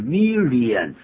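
"million" misread as three syllables, mi-li-an; common mispronunciation. Shown as a demonstration. Teacher showing how learners often misread "million", as mi-li-an. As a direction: say "million" with a separate stressed "li". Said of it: "我们常常会念成million".

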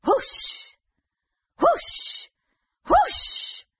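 rising breathy whooshes; magic whoosh. Acted. Magic sound effect rising.

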